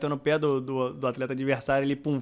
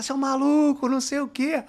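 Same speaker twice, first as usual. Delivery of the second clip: parents yelling insults; 10 semitones higher than usual. Retold or imitated. Parents heckling the coach after the match, mock angry and higher. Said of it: "os pais começaram a gritar".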